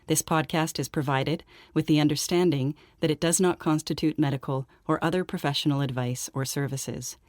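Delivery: soft, even legal-notice read; disclaimer read. Acted. Host reads listeners the show's medical disclaimer, soft formal read. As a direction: read it out quietly and evenly, like small print.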